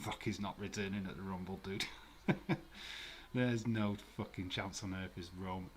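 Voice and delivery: ordinary talk, nothing marked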